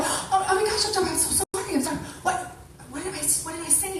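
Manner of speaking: high-pitched, half-laughing imitation of apologizing; hammed-up sheepish partner; flustered apology. Business partner apologizing to the coach, flustered. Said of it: "they just kinda go".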